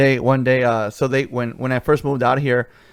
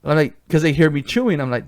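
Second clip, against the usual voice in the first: ordinary delivery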